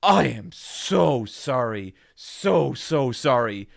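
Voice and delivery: mock-mortified, every word dragged out; over-the-top apology. Tourist apologising to shopkeepers, slow and grovelling. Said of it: "just being like".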